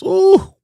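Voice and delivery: high, loud, drawn-out "ooh"; high-pitched "ooh". Acted. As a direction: let out a sudden high, hooting "ooh". Teasing reaction to two similar words.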